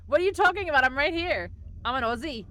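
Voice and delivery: bad Australian accent, playfully indignant. Streamer protesting to chat in loud mock Aussie accent. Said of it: "that absolutely terrible Australian accent".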